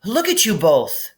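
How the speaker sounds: lightly voiced frantic girl; a hint of alarm; alarmed Hermione. A narrator's reading.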